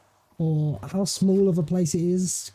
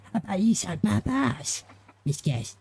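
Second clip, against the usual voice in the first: high, bratty cartoon kid voice; Cartman impression; 7 semitones higher than usual. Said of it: "we got Cartman".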